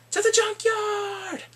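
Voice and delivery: high, slow, drawn-out call; rallying cry. Pretend. Sending everyone off to search, hammed-up rallying cry. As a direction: sing out a mock battle cry.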